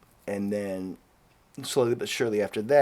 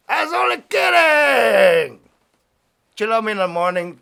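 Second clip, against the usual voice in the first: hammed-up gangster shout, much higher and louder; Scarface; 10 semitones higher, 8 dB louder than usual. Host to listeners, quoting the movie, hammed-up shout. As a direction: bellow it like a movie mobster. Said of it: "Remember Scarface?".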